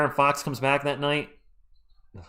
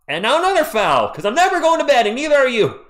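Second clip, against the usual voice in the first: higher, louder exasperated complaint; 8 semitones higher, 5 dB louder than usual. Real, not acted. Streamer tells viewers nobody sleeps tonight, exasperated. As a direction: groan at yet another foul.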